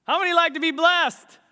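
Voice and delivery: loud, high call to the congregation; rally the room with the question; preacher's call out. Real, not acted. Preacher rousing the congregation, loud call. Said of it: "say it like you mean it".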